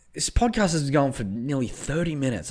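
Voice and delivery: normal talking voice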